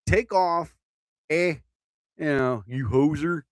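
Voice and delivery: mock Canadian accent with a punchy start. Showing off a Canadian accent to viewers, mock Canadian. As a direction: ham up the Canadian slang accent. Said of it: "I don't know if that's my best Canadian accent ever".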